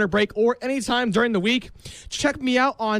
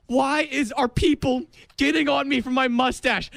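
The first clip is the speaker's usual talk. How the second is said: normal talking voice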